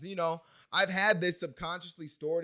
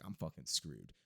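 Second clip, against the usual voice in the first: low, soft inner groan in words; low defeated aside; 10 semitones lower, 12 dB quieter than usual. Retold or imitated. Learner overwhelmed by vocabulary, low defeated aside. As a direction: drop it down, quietly resigned. Inner reaction to rattled-off phrases.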